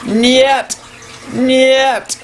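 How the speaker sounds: high mock Russian yell of no; mock Russian shouting into a hotline. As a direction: shout it like an angry Russian on the phone. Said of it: "is yelling out".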